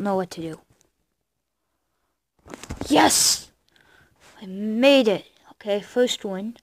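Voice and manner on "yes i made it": loud victory cheer; triumphant shout. Real, not acted. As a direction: yell it out in triumph. Winning the round.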